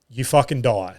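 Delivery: ordinary talk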